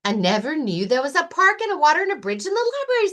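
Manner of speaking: high, fast wonder-struck bunny; amazed Rabbit. Acted. Rabbit marvelling to Lion at the library, high and fast. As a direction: rattle it off in amazement.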